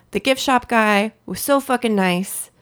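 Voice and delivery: ordinary talk, nothing marked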